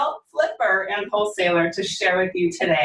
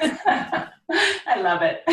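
delighted, laughing through the words, pitched up and drawn out; 8 semitones higher, much slower than usual. Real, not acted. Host delighted by guest's Texas twang, laughing. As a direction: laugh it out warmly, stretching it slowly.

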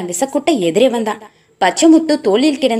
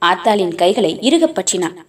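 ordinary delivery, nothing marked

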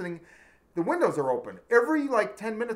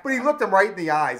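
ordinary delivery

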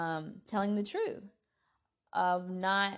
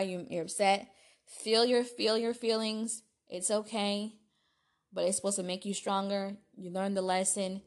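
ordinary talk

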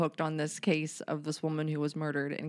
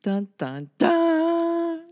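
dun dun dun; 11 semitones higher, 6 dB louder, much slower than usual. Pretend. Dramatic sting sound effect.

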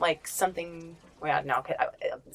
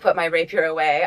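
usual speaking voice

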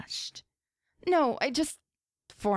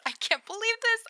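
excited; 14 semitones higher than usual. Acted. Text repeated, excitement rising.

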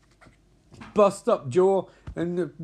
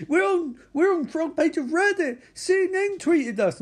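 giddy bragging; 12 semitones higher than usual. Retold or imitated. Bertie bragging in retold movie.

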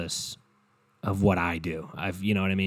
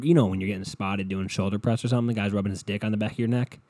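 ordinary talk, nothing marked

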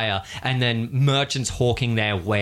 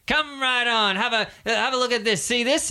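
recruiter sales pitch; 7 semitones higher than usual. Acted.

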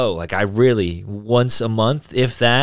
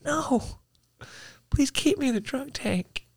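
high, softer mock begging; mock pleading; 13 semitones higher, 10 dB quieter than usual. Begging the guard to stay in the drunk tank, mock pleading. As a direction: beg pitifully not to be moved.

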